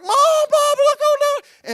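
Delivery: high, loud, hammed-up panicked cry; mock panic. Son shouting to his mom in fake panic.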